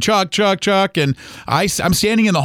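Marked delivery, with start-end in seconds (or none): 0.0-1.0 retold mock scream. Son retelling mom screaming for dad, mock scream. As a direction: mildly mimic a frantic cry, pitched up. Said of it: "she's screaming and yelling and crying".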